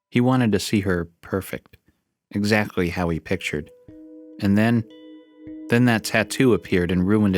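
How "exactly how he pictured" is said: ordinary delivery, nothing marked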